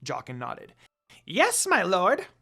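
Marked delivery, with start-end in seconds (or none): 1.2-2.3 high, loud eager little servant voice; high loud imp reporting to his master; squeaky servant imp. Acted. Jokin voice again.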